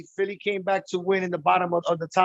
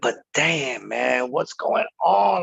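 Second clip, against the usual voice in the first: normal talking voice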